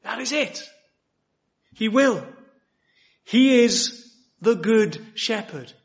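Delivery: ordinary talk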